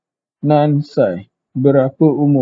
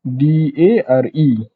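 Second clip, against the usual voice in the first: D A R E spelled out letter by letter, slowly; slow spelling; much slower than usual. Shown as a demonstration. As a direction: read out each letter slowly. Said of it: "perkataan seterusnya adalah". (eja D A R E huruf demi huruf perlahan)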